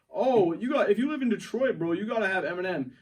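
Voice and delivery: high, loud mocking whine; loud, fast parody of the others; mocking friends. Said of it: "you guys are like".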